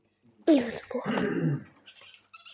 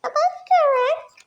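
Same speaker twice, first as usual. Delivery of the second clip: much higher, squeaky pet voice; squeaky cute voice; 13 semitones higher, 3 dB louder than usual. Pretend. Asking her quails in a cute squeaky voice. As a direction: squeak it like a tiny bird. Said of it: "repeats the question in a put-on voice".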